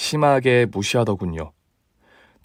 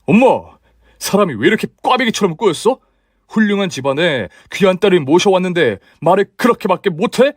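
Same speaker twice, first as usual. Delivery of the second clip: shouting at mother; 6 semitones higher than usual. Acted. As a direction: yell it at her, outraged. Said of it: "큰 소리로 시어머니한테 소리쳤어요". (엄마에게 소리치는 아들, 크고 높은 고함)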